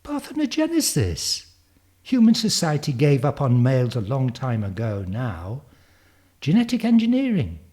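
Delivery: put-on high, loud, lecturing girl prodigy; Zanzi. Girl explaining evolution to Darwin, high and emphatic child voice.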